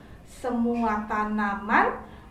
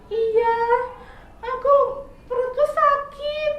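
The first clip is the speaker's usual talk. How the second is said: much higher, louder, whiny little caterpillar; sick caterpillar; 12 semitones higher, 6 dB louder than usual. Acted. Caterpillar telling squirrel his stomach hurts, high whiny voice. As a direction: answer like a sorry sick child.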